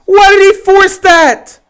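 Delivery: high, loud, slow exasperation; exasperated yelling. Real, not acted. Streamer scolding Dak for forcing the throw, yelling. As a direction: yell at him for the bad throw. Ranting at the interception.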